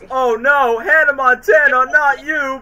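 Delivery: high, loud hammed-up cry of shock; mock dismay. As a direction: overplay the horror at the loss. Mock grief over a parody death.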